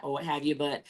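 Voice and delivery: usual speaking voice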